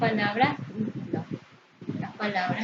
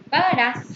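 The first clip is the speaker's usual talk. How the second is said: "Pagarás" higher and louder, hitting -rás; pa-ga-RÁS, raised and emphatic; stress on last syllable; 4 semitones higher, 6 dB louder than usual. Shown as a demonstration. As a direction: punch the final syllable of "Pagarás". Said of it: "Tiene acento prosódico en su última sílaba".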